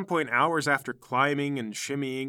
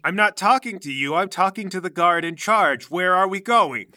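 imperious noblewoman, louder and higher; imperious demand; 3 semitones higher, 6 dB louder than usual. Acted. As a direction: demand an answer from an underling. Noblewoman snaps at the guard.

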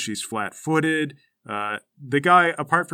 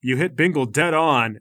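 usual speaking voice

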